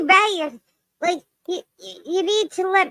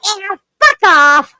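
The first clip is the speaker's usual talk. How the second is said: loud, sharp and higher; 4 semitones higher, 7 dB louder than usual. Shown as a demonstration. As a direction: bark it out cheekily. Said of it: "I get to say things like".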